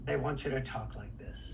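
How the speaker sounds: low, soft, understated delivery; real, natural voice. Shown as a demonstration. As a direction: drop it right down and just talk. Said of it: "They just want your voice".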